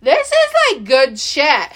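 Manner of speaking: high, thrilled gush; excited. Retold or imitated. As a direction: gasp with delight at the find. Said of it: "And I was like".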